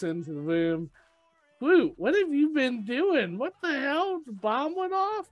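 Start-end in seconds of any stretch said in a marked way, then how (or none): 1.6-5.3 much higher, louder Rocket scolding Groot; squeaky exasperated raccoon yelling at a kid. Retold or imitated. Rocket scolding Groot, high exasperated voice.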